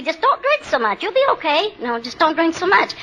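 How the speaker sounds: a hint of people handing out simple advice; mock well-meaning advice. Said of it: "People used to say to me".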